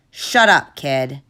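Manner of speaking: loud curt snap; angry snap. Acted. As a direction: shut the boy up. Guard snaps at Noah.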